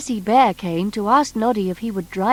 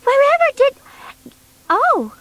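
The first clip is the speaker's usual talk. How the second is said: put-on high, sweet girlish voice; Tessie Bear's voice; 14 semitones higher, 4 dB louder than usual. Tessie telling Noddy about Uncle Bear, high girlish voice. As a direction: lift it up as little Tessie.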